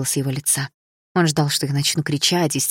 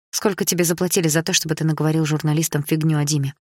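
ordinary delivery, nothing marked

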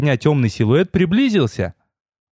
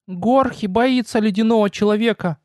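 high, thin timid little goblin voice; 9 semitones higher than usual. Acted. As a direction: squeak it out like a frightened goblin. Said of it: "послышался тонкий голосок". (тонкий голосок гоблина)

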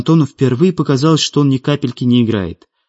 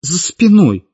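high, drawn-out plaintive question; 9 semitones higher, slower than usual. A narrator's reading. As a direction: wail it lightly, as a complaint. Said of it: "жалобно спросила Светлана".

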